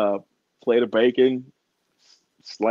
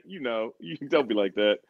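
ordinary delivery

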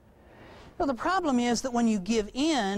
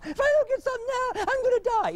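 put-on high, fast whining kid voice; high-pitched child demanding food now; whiny child. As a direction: pester like a hungry little kid. Child dramatizes hunger.

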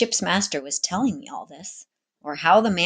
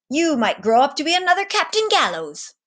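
lofty, theatrical captain's voice, pitched up; grand sea captain; 7 semitones higher, 4 dB louder than usual. A narrator's reading. Captain grandly addressing the cabin boy, theatrical voice. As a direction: play a showy ship's captain holding court.

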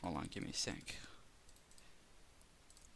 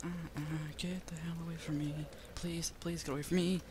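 whispered, higher, pleading; terrified whisper; 7 semitones higher than usual. Real, not acted. Player begging a monster to leave, terrified whisper. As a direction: breathe it, too scared to speak up.

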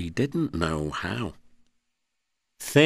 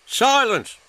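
much higher, louder sharp order; snapped command; 5 dB louder than usual. A narrator's reading. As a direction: cut them off. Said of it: "snapped the Fat Controller".

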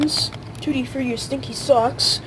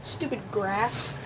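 disgusted mutter; 3 semitones lower, 8 dB quieter, slower than usual. Real, not acted. Eating a grass bean again.

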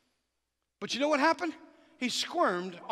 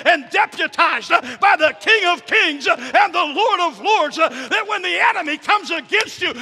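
louder and higher, rising to a slow shouted climax; full-force preaching; 9 semitones higher, 13 dB louder, slower than usual. Acted. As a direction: preach it hard, hurling defiance at the devil.

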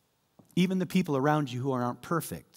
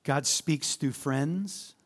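"God" rising, very slow; slow emphatic; much slower than usual. Shown as a demonstration. Pastor ending the list, slow emphatic. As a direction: drag the words out. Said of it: "Look at me here".

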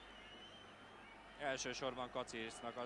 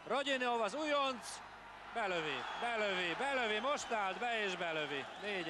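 excited commentary; 6 semitones higher than usual. Real, not acted. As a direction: cry it out again and again. Newcomer scores.

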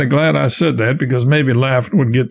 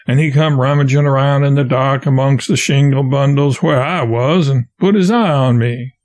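usual speaking voice

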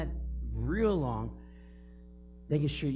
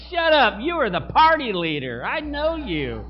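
loud, high teasing retort; mock call-out; 7 semitones higher, 12 dB louder than usual. Preacher retorting to old party friends, loud mock call-out. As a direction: call them out loud, half laughing. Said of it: "it's like".

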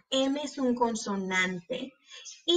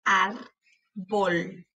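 "Árbol" slowly as ár, pause, bol; split into syllables. Shown as a demonstration. Teacher showing both closed syllables, syllable by syllable. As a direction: break "Árbol" into two separate syllables. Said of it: "para ver cuáles de esas sílabas".

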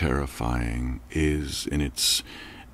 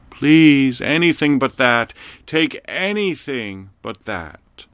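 insistent whine; 7 semitones higher, 5 dB louder than usual. A narrator's reading. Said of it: "the insistent whine refuses".

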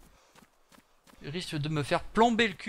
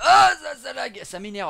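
loud, high cry of annoyance; annoyed outburst; 9 semitones higher, 12 dB louder than usual. Real, not acted. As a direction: explode at the game's lag. Game lags, he is annoyed. (cri d'agacement, fort et aigu)

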